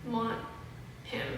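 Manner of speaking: ordinary talk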